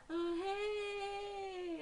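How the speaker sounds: high, drawn-out hello; mock sing-out hello from a friend; imitated friend's greeting. Ebony's retold greeting after dunking.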